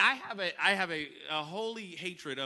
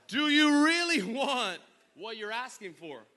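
ordinary delivery

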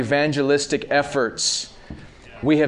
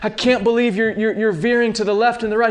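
Paul pleading; 8 semitones higher than usual. Retold or imitated. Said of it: "he goes".

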